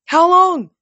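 high, loud shouted question; alarmed cry. A narrator's reading. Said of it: "Rachel cried".

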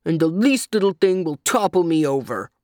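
gruff, put-upon creature voice; grumbling. A narrator's reading. Creature complains of toppling over easily, grumbling. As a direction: keep up the aggrieved grumble. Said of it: "grumbled the monkey".

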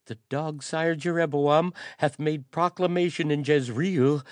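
high, loud, frightened plea; groveling cry. A narrator's reading. Groveling courier crying to the hero for forgiveness. Courier delivers the doom proclamation.

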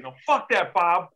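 high, loud angry retort; imitating the old guy's angry refusal. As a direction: spit it back defiantly. Old guy refusing again.

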